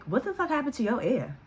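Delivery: high-pitched, taken aback; baffled question. Retold or imitated. Storyteller to injured guy about his ear, baffled. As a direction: ask it in rising disbelief at the sight.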